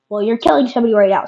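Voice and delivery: ordinary talk, nothing marked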